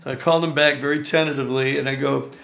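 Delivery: ordinary talk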